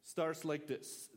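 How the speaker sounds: usual speaking voice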